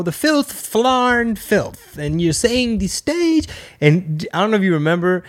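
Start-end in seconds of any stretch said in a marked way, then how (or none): 0.0-3.5 smug, judgy impression, high and drawn out; slow, high-pitched scolding of filthy language; Bill Cosby. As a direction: stretch it out like a prudish comedy elder. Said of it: "Bill Cosby is smug and judgy".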